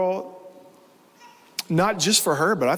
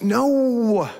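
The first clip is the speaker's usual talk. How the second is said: higher, drawn-out cry of disbelief; anguished retold no; 7 semitones higher than usual. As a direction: relive the shock in one long no. Retold reaction to abduction and murders.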